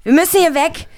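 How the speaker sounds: alarmed call to flee; urgent. Acted. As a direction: push everyone to leave now. Fleeing the monster.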